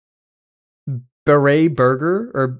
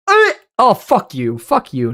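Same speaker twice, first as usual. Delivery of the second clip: playful louder outburst at chat; mock outrage; 7 semitones higher, 5 dB louder, slower than usual. Real, not acted. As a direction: jokingly fire back at the voters.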